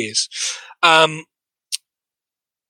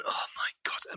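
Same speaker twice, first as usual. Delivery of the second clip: whisper; 19 dB quieter, faster than usual. Real, not acted. Aghast at the awful impression.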